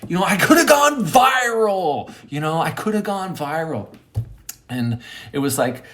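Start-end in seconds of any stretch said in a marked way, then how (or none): 0.1-2.1 high, loud mock wail; frustrated outburst. Storyteller lamenting lost viral fame, loud and frustrated. 2.3-3.9 raised pitch, ordinary volume; restrained lament over missed fame; toned-down frustration. Retold or imitated. Storyteller repeating his lament, frustrated but calmer.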